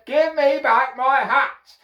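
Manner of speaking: high, loud, slow demand; angry demand. A narrator's reading. Hatter pointing both fingers at monkeys, angry demand. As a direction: shout it slowly at the thieves.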